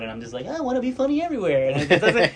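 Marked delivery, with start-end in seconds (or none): 0.5-1.7 high-pitched, quick drunk imitation; drunk mode. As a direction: play your own tipsy self, pitched up and hurried. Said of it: "in my drunk mode".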